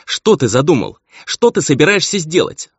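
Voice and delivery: high, urgent demand; crying out. A narrator's reading. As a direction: burst out as if shaking the answer from him. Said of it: "хотелось крикнуть ей".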